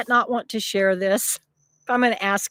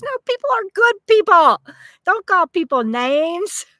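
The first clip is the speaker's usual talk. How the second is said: mock scolding; 7 semitones higher, 6 dB louder than usual. Mock scolding reply to viewer comment.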